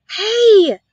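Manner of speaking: put-on boy startled to find a mouse, surprised cry. A narrator's reading. Surprised call. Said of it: "whispered the boy, obviously very much surprised".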